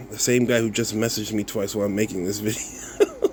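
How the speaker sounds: usual speaking voice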